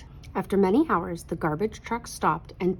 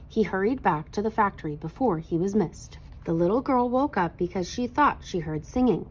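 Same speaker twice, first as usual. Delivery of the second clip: ordinary delivery, nothing marked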